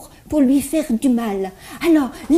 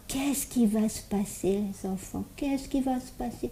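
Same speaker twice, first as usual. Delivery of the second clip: hushed suspense; 13 dB quieter than usual. Acted. Hushed build-up to sad moment.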